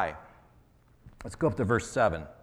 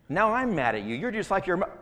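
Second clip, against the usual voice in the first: mock anger; 8 semitones higher than usual. Adam retorting in the quarrel.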